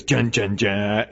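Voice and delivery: low, slow descending dun-dun-dun; imitated sad music. Mimicking the show's music as the canoe sinks. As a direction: hum the sad trombone sting. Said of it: "the music kinda goes".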